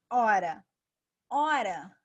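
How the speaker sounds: hora said loud, starting on the open O; silent H. Shown as a demonstration. Teacher showing students the H is silent in hora. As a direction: say hora with no H sound at all. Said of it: "o H não tem som".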